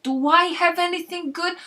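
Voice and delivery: high, loud, put-on New Yorker outrage; mock offended Frank. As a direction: act wounded at the question. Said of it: "feigned offense".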